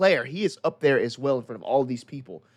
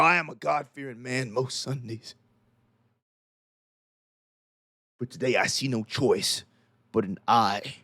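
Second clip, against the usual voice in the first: vengeful priest; 2 semitones lower than usual. Acted. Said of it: "he says".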